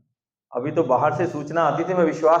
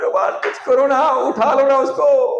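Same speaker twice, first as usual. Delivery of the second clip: high, loud pretend wailing to God; mock tearful wail; 10 semitones higher, 5 dB louder than usual. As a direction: drag it out like a melodramatic worshipper begging. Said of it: "भगवान के सामने रोते हैं".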